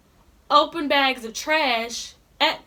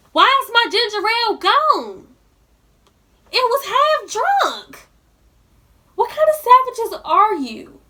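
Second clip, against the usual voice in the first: higher, louder indignant outburst; outraged; 7 semitones higher, 3 dB louder than usual. Real, not acted. Scolding roommates who drank her ginger ale, outraged. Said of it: "So now I'm mad".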